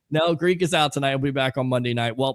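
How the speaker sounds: normal talking voice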